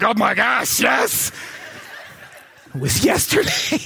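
high excited shouting with a laugh. Retold or imitated. Ladies in the crowd shouting excitedly that they cried yesterday. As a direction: gush it out, laughing. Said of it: "They're like".

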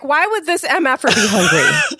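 new mom's exasperated retort; incredulous outburst. Retold or imitated. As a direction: bark it back in disbelief. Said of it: "I'm like".

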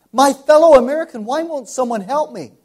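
high, loud echoing plea; heard pleading voice. Retold or imitated. Preacher recalling the loud, high voice that called him. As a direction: cry it out as if the voice still rings.

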